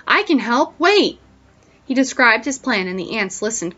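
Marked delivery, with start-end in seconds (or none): 0.1-1.2 higher, louder plea; eager shouted offer of help; urgent yell. A narrator's reading. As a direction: call after them to come back. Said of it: "he yelled, racing after".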